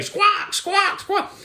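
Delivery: very high-pitched squawks; piercing imitated bird cries; parrot squawk.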